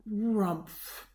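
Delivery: a short grumpy grunt; bear growl. A narrator's reading. The bear leaving into the night, growling. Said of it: "he growled".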